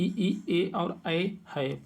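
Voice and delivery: slow, separate "ई, ए और ऐ"; धीरे-धीरे स्वर उच्चारण; vowels spelled slowly. Shown as a demonstration. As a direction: sound out "ई, ए और ऐ" one at a time, slowly. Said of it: "अग्र स्वर कहे जाते हैं".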